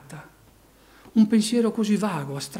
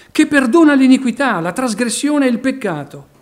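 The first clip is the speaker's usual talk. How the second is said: preaching at full force; 6 semitones higher, 10 dB louder than usual. Acted. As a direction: drive each phrase home like a revival preacher. Proclaiming God's attributes.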